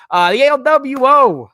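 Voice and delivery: a loud, slow, hammed-up announcer accent on "the L W O"; odd put-on accent. Host reading a chat name in a goofy accent. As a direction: drawls "the L W O" loudly in a strange mock accent. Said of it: "The fuck was that accent?".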